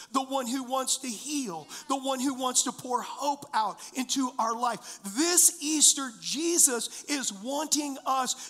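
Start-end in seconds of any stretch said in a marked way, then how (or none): 5.2-8.5 full-force preaching. Acted. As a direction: lean in and proclaim it like the Easter climax. Builds to the sermon's climax.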